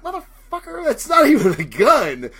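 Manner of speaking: high, stunned squeal that tips into a laugh; a reader yelping at the mime's fake gun; shocked outburst. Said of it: "you're like".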